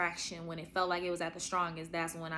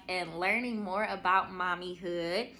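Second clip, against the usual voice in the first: ordinary delivery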